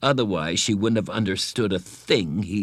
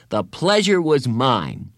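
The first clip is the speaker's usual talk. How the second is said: slow, careful formal reply; proudly polite; 5 semitones higher, 4 dB louder, slower than usual. A narrator's reading. Bradley proudly giving Carla his rehearsed polite reply. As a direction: say the rehearsed line with pride.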